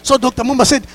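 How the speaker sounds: usual speaking voice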